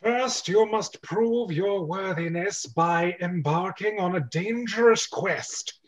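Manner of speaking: slow, solemn wizard pronouncement; grand wizard. Acted. Wizard Walton to the plumbers, slow and solemn. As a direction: intone it like an old wizard setting a quest.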